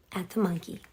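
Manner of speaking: ordinary delivery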